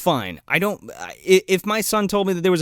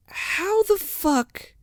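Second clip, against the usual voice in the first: slow, high, incredulous delivery; exaggerated bewilderment, pitched up; mock disbelief; 10 semitones higher, slower than usual. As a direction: stretch it out in baffled disbelief. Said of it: "And I'm like".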